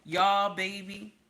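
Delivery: ordinary delivery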